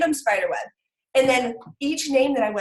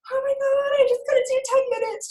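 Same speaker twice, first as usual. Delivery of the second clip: mock exasperated wail; 14 semitones higher than usual. Mother facing head-banging over homework, exasperated wail. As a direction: wail it in hammed-up despair.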